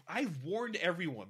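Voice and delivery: ordinary talk, nothing marked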